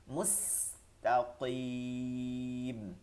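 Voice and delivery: "মুস্তাকিম" with the last ki drawn out very long; long madd. Shown as a demonstration. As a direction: stretch the "কি" in "মুস্তাকিম" for three alifs. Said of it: "তিন আলিফ টেনে পড়তে হবে". ("মুস্তাকিম"-এর "কি" তিন আলিফ টেনে)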